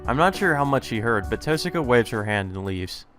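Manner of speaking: ordinary delivery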